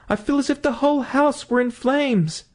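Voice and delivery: high, strained voice full of dread; frightened wife. Acted. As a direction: sound like the house is burning around you. Wife in terror continues.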